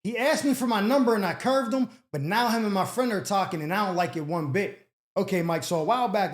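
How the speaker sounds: ordinary talk, nothing marked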